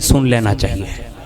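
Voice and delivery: preacher delivers the moral, deep and slow; weighty punchline. Acted. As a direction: let the lesson sink in slowly.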